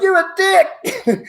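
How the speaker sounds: high, drawn-out mock insult; punchline to the fellow fan, high and slow. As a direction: stretch it high and slow for the laugh. Comic punchline to the hater.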